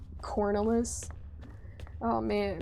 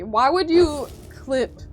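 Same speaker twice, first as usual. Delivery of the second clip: usual speaking voice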